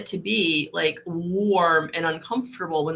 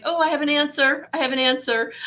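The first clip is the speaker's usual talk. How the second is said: excited realization; 8 semitones higher, 4 dB louder than usual. Retold or imitated. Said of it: "I was like".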